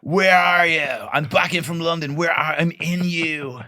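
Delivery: hammed-up gushing homecoming cry; mock homecoming emigrant. Emigrant back from London hailing Dublin, high and gushing.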